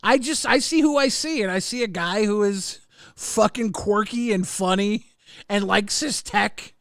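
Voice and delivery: ordinary talk, nothing marked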